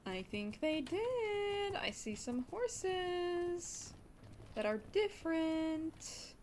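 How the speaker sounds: high, very slow gasping delight; breathless thrilled awe; excited high voice. Real, not acted. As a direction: stretch each word out in giddy wonder. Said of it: "I don't know why I'm talking like this".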